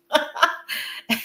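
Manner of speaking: airy high laugh, no voice; amused breathy chuckle. Real, not acted. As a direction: laugh lightly. Laughs about mother.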